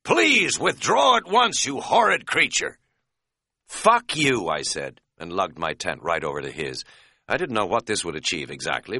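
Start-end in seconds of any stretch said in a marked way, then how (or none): 0.0-2.8 high, loud, over-polite mock shout; hammed-up prissy pleading, shouted; merciless mocking imitation. A narrator's reading. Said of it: "Katz shouted in merciless imitation". 3.7-4.5 loud, higher, irritated comeback; peeved outburst; stung retort. A narrator's reading.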